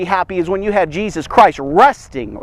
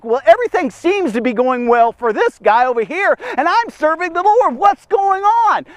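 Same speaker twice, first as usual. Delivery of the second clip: mock whiny complaint; 7 semitones higher than usual. Preacher voices envious complaining believer.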